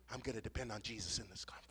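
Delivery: whispered, fast and intimate. Real, not acted. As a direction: breathe the vow softly. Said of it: "whispered restatement".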